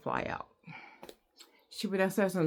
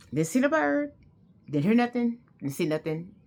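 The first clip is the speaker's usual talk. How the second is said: higher and louder, punching each claim; loud proud triumph; triumphant; 6 semitones higher, 4 dB louder than usual. Real, not acted. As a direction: announce your victory over the fear. Triumphant report, loud and high.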